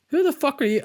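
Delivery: high-pitched mock bewilderment; incredulous question. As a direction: retell it baffled, pitch jumping up. Retold reaction to a stranger's approach.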